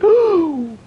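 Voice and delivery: sharp, high gasp; startled gasp. Real, not acted. Streamer startled by a near miss in game, high gasp. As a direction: gasp high in sudden alarm.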